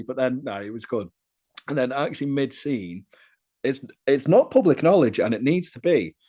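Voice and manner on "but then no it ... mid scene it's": ordinary talk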